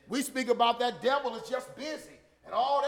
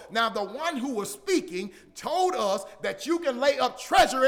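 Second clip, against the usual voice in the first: loud, driving and higher; full-force preaching; 5 semitones higher, 7 dB louder than usual. Acted. Preacher urging the church toward heaven, loud and driving. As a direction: hammer the promise home to the pews.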